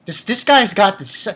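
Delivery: ordinary talk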